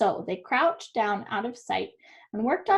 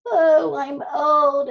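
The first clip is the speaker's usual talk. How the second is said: slow, louder, lightly voiced old rooster; 4 semitones higher, 8 dB louder, much slower than usual. Rooster explains to the donkey that he is old, slowly. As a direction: sigh it like a tired rooster. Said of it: "explained the rooster".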